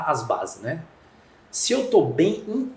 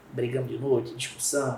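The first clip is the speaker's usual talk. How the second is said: ordinary delivery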